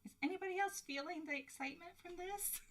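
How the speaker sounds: high, fast and giddy; excited. Real, not acted. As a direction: bubble over with excitement. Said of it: "Am I the only one that is excited?".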